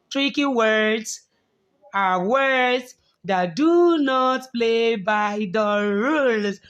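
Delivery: slow, careful teacher definition. Shown as a demonstration. Teacher slowly defines tricky words for the class. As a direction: spell the rule out slowly for the class. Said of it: "Yes, tricky words are words that do not play by the rule".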